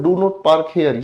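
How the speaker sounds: each word slow and deliberate; slow prohibition. Shown as a demonstration. Teacher reading a no-parking sign slowly to the class. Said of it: "कहीं पर लिखा हुआ है".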